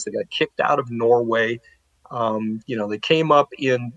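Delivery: ordinary talk, nothing marked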